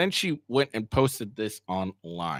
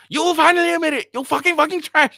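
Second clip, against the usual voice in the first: much higher and louder, fast, mocking screech; mocking shrill girlfriend; 9 dB louder than usual. Mocking the texts of an angry ex in a squeal. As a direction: squeal it out like a mocking caricature of her.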